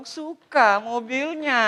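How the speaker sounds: very slow, drawn-out admiring coo; imitated wife. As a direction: stretch it out like a smitten wife. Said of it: "istri saya bilang".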